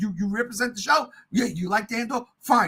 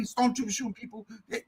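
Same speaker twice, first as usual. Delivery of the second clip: ordinary talk, nothing marked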